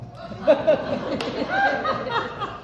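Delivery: high burst of laughter. Real, not acted. Telling the audience about the chainsaw, high laugh.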